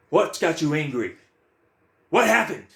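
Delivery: high, loud, pressing questions; probing shout. Acted. Questioning his own mind about its anger, high and loud. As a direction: demand answers from yourself.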